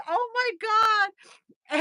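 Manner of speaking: high, long drawn-out retold wail; overwhelmed dismay. Patient reliving the painful session, overwhelmed dismay. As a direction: stretch it out very slowly in a high voice.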